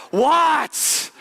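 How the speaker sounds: put-on a worn-out mom finally snapping at her kid; exasperated what. Mom fed up with the endless calls, snapping. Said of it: "And you're just like".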